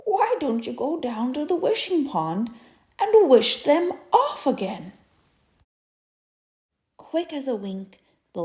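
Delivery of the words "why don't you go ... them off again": kindly groundhog advice. Acted. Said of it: "said old Mister Groundhog".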